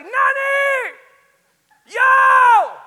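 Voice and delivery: high, drawn-out scream for an idol; starstruck fan yelling. Retold or imitated. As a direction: scream the name at full volume. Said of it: "yelling at the top of my lungs".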